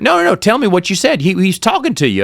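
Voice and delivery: eager teacher. Retold or imitated. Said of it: "He went".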